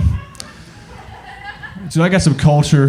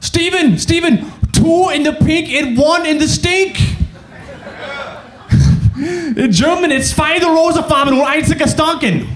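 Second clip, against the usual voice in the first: put-on Austrian accent; 11 semitones higher than usual. Austrian friend showing Steven an internet phrase, high Austrian accent. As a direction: imitate an Austrian proudly sharing new slang, high. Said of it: "They come up to me".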